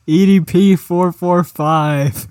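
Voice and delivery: hammed-up meme announcer; meme announcement. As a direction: call out each letter slowly. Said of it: "announcing a meme name".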